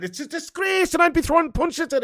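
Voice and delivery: indignant fan blowing up; outraged. Real, not acted. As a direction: spit it out in disgust. Ranting about Maguire captaining.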